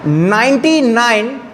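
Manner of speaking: Ninety nine said very slowly while writing; teacher writing out the number for students; slow dictation. Shown as a demonstration. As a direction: dictate slowly as you write on the board. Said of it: "मैं एक language में लिख रहा हूं".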